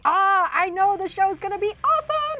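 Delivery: excited tweet read-out, rising high; all-caps excitement. Retold or imitated. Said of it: "That was all caps".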